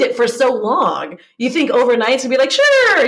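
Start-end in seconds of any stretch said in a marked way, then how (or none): 2.5-3.1 very high, loud, drawn-out sure; mock eager agreement. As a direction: gush it in an exaggerated high pitch. Voicing an imagined over-eager brain.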